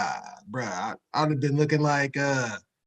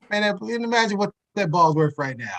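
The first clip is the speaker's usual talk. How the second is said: ordinary talk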